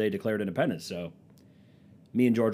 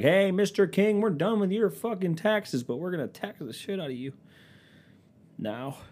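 mock colonist; 10 semitones higher, 3 dB louder than usual. Colonists voiced telling off the king.

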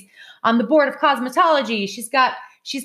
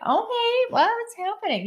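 put-on much higher, bewildered child's question; confused kid; 13 semitones higher, 4 dB quieter than usual. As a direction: act out a lost, confused kid. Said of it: "They're gonna be like".

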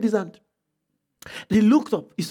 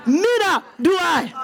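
slow, tender voice of Jesus; 4 semitones higher, slower than usual. Retold or imitated. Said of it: "He said".

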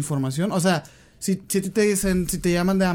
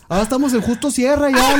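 lie to him while cracking up, a bit louder; laughing through it; 8 semitones higher, 7 dB louder than usual. Real, not acted. Lying to the scam caller while laughing, louder. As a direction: barely hold the lie together through laughter.

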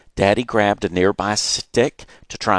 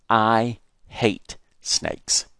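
plain reading voice

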